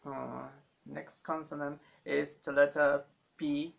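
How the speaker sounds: normal talking voice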